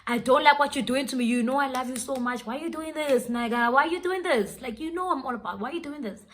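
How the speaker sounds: acted-out frustrated pleading, pitched high; frustrated outburst. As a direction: plead in exasperation, re-enacting the confrontation. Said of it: "'cause I was so frustrated".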